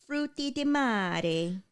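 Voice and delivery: slow Italian accent, savoring it. Shown as a demonstration. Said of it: "Pretty good Italian accent there".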